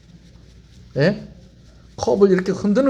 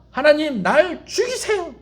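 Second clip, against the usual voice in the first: mock defiant plea; 8 semitones higher, slower than usual. Jonah voiced as defiant.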